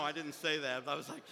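normal talking voice

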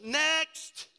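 high, loud shout to a waiting line; big mock store-clerk yell; calling out. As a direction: holler it like a checkout clerk.

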